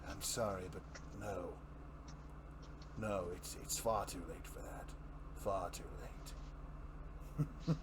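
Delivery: low, soft, resigned refusal; subdued Joker. Acted. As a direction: quietly turn down the offer. Joker's reply in reading.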